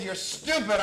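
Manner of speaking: loud, high mock accusation; angry insult. Client insulting the therapist, loudly angry. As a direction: hurl it as an acted insult.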